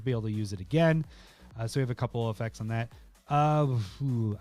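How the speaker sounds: ordinary talk, nothing marked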